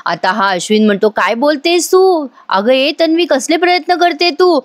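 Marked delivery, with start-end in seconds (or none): 1.1-4.6 higher, puzzled asking; a surprised man asking what she means; surprised question. Retold or imitated. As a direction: Ashwin surprised, asking Tanvi. Ashwin surprised at Tanvi.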